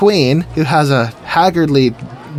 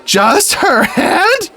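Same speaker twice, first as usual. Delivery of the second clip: very high, very slow disbelief; incredulous; 3 dB louder, much slower than usual. Retold or imitated. Gate dude hearing the request, hammed-up incredulity. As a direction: stretch it out in comic disbelief. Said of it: "And then incredulously".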